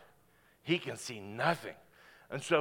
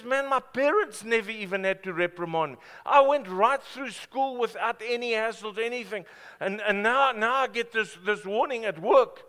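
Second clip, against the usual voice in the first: louder and higher indignant disbelief; upset retelling; 7 semitones higher, 9 dB louder than usual. Retold or imitated. A broken lady tells the pastor about her warning. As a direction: pour out the shock of it. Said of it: "she was broken".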